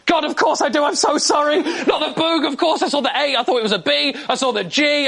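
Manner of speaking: high, gushing mock apology; flustered apology. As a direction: backpedal instantly, hammed-up. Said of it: "would immediately go".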